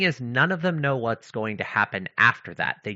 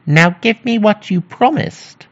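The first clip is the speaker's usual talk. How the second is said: demanding mannikin; 12 semitones higher, 12 dB louder than usual. Acted. Mannikin claiming his promise from the queen, loud demand. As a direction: draw it out as a high-pitched little creature.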